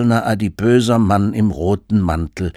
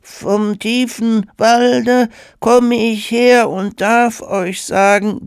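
very high, slow, loud rote verse; mechanical child recital; 4 dB louder, slower than usual. A narrator's reading. Child recites Christmas poem to Santa, mechanically. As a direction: recite by rote like a little kid. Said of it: "startete mechanisch seinen Vortrag".

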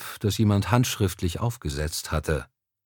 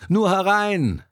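hoog uitgeroepen van binnen; roepend; 10 semitones higher, 3 dB louder than usual. A narrator's reading. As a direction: call it out, high-pitched, to someone at the door. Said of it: "hörte er von drinnen jemanden rufen".

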